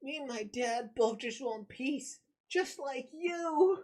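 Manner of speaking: put-on high, slow, earnest child voice; kid voice. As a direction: read it as a hopeful young boy. Reading a kid's manga line.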